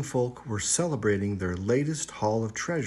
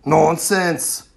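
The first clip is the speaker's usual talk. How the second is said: louder, dismissive chief shout; 4 semitones higher, 9 dB louder than usual. A narrator's reading. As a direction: cut her off loudly. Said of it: "the chieftain shouted".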